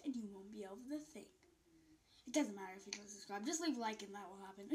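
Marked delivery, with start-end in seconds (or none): none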